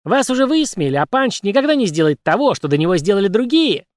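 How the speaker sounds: high whistling birdlike voice; cartoon bird. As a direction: keep the chirpy bird squeak. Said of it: "свистящим птичьим голосом".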